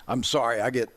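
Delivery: real emotion, holding back tears; choked up. Real, not acted. Storyteller apologizing mid-memory of his dad's phone call, choked up. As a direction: pause and push through a catch in the throat. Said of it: "a little emotional".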